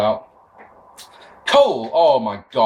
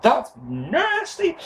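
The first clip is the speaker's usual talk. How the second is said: mock disgusted; 10 semitones higher, much slower than usual. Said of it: "And you're like".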